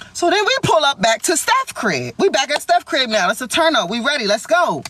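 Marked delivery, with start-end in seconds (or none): none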